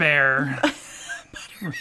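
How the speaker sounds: Butterbear with the last syllable rhyming with "air"; mispronounced Butterbear. Retold or imitated. Co-host quotes a video mistake, Butterbear said with a long "bear".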